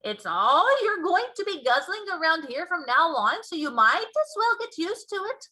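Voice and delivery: loud, high, teasing giant; BFG teasing. Acted. Giant urging Sophie to eat, teasingly. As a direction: coax her to try it.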